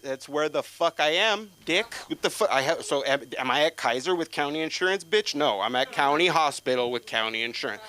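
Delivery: sarcastic, shouted indignation; exasperated rant. Acted. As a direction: snap back at the obvious. Angry retort to hospital.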